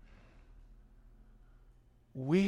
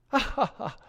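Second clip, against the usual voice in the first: laughing it off; 10 semitones higher, 5 dB louder than usual. Retold or imitated. Friend laughs off bad news.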